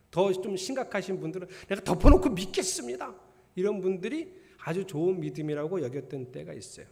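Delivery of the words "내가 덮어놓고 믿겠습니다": loud, high mock-fervent pledge; impersonated zealot, pitched up and forceful; zealous vow. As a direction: declare it like a blindly fervent believer. Imitates even more zealous believers.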